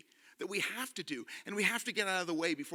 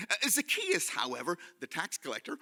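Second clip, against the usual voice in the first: normal talking voice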